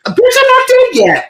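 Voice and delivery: very high, loud imitated screech; mock outraged yell. Retold protest from the body bag.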